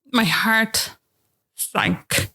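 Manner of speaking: shaky breath, last word breathed out; tearful sigh. Real, not acted. Mother to a friend, recalling the scan, sighing through tears. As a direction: sigh and let it sink.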